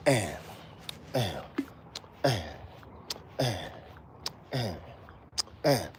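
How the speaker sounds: muttered and on every offbeat; soft count of ands. Shown as a demonstration. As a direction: softly voice each and. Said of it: "Acknowledge it with your voice".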